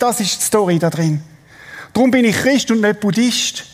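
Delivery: emphatic, driving sermon delivery; impassioned preaching. Real, not acted. Preacher assuring the congregation that Jesus holds them, impassioned preaching. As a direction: preach it with conviction, pitch lifted.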